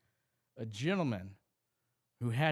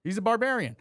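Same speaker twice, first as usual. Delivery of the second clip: excited; 8 semitones higher, 9 dB louder, faster than usual. Real, not acted. Echoing friend's barbarian joke.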